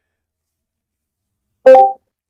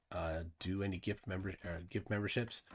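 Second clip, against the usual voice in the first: whisper; 4 semitones lower, 10 dB quieter than usual. Real, not acted. Testing, trying not to be heard.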